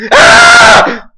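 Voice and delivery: very high, loud shriek; sudden scream. Real, not acted. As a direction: cut yourself off with a scream. Sudden scare mid-sentence.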